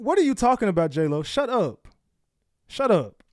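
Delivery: loud fed-up shut up; annoyed yelling. Real, not acted. Streamer telling J-Lo on screen to shut up, loud annoyed.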